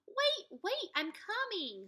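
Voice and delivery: high urgent call; calling after. Acted. Jack calling after Annie at the door, high urgent call. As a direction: hurry to stop her leaving.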